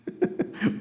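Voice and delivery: short laugh at an absurd example; amused laugh. Real, not acted. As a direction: chuckle without words.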